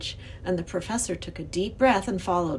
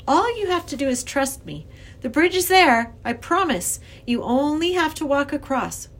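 put-on higher, earnest child character; persuasive young girl; 6 semitones higher, 4 dB louder than usual. A narrator's reading. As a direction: reason insistently with the frightened professor. Lindy persuades the professor.

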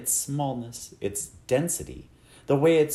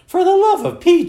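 high, loud burst of impatience; shouted exasperation, pitched way up; exasperated outburst; 12 dB louder than usual. A narrator's reading. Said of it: "shuddered her mother".

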